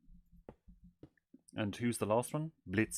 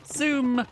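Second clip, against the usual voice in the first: a high, loud sound effect zoom; 8 dB louder than usual. Pretend. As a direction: shout Zoom! high like a racing car. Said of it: "Fast as fuck".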